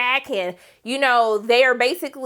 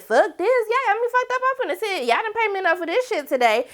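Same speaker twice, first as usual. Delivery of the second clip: high, indignant rant; fed-up quitting; 9 semitones higher than usual. Retold or imitated. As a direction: storm out complaining. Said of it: "She said".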